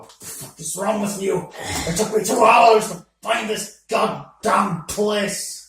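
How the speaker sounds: furious complaint after hours lost; angry rant. Acted. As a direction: vent the frustration. Character angrily complains.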